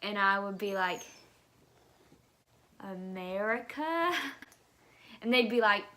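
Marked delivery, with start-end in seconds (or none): 2.8-4.1 slow, drawn-out tentative answer; hesitant questioning answer. Retold or imitated. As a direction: answer slowly, as if asking. Timid answer to where from.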